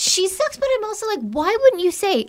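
exasperated. Real, not acted. Exasperated raised pitch.

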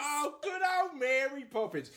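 high, far-from-plain exclamation; delighted cheer. Real, not acted. Cheering the child's next pick.

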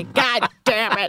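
lightly exaggerated captain, drawn out; slow, exasperated mock curse; Captain Lee. Imagining the boat captain's reaction, exasperated impression. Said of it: "Could you imagine Captain Lee?".

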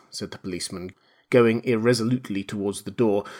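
ordinary delivery, nothing marked

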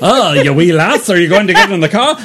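hammed-up Irish leprechaun voice; mock Irish brogue, fast and bright. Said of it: "deeply terrible".

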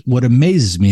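ordinary delivery

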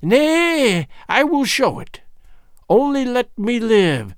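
high, wailing hag's surrender; pleading old hag. A narrator's reading. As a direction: beg for life in a high, stretched voice. Gagool gives in, begging.

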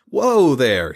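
loud, high calming whoa; urgent whoa. Acted. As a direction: break it up loudly. Stepping between two fighters.

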